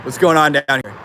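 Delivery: ordinary talk, nothing marked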